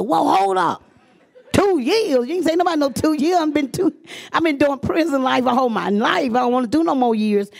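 high, fast outraged disbelief; shocked protest. Retold or imitated. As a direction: balk at the two years, pitch jumping up.

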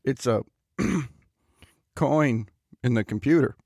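low, halting bro voice with a throat-clear; stall, then offer a feeble guess; floundering bluff. Acted. Host caught out by co-host's question, hesitant bluff.

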